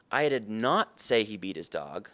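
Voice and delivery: "not" stretched and punched, slow delivery; stress on NOT. Shown as a demonstration. Sales trainer showing how intonation changes a statement, stress on "not". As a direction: lean hard on the word "not". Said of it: "depending on which word I emphasize".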